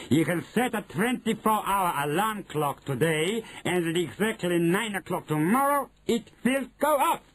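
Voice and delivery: high put-on witch voice; Grand High Witch, triumphant. Grand High Witch explaining the timer, triumphant. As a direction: lay out the plan from on high.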